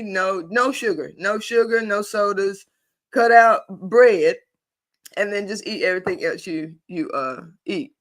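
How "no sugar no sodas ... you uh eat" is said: ordinary talk, nothing marked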